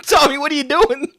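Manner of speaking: imitating Matt. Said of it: "And Matt's like".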